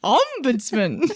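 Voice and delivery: Ombudsman slow, first syllable stretched; OM-budsman, leaning hard on the front; stress on OM. Shown as a demonstration. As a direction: draw out the OM in Ombudsman. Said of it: "It's that part that I have an issue with".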